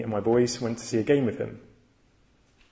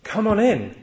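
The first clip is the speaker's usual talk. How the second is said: higher-pitched, delighted imitated welcome; imitated smiling guard, pitched up and welcoming; 4 semitones higher, slower than usual. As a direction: voice the guard suddenly all smiles. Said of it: "he said with a smile".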